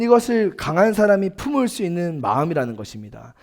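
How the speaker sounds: usual speaking voice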